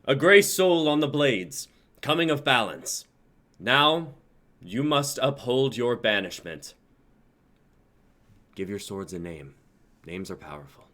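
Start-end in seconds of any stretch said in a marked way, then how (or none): 0.1-6.8 high, loud, astonished proclamation; astonished yell. Acted. Council head yells to the crowd, astonished. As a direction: cry it out to the whole crowd. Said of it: "Magnus yells to the crowd, astonished".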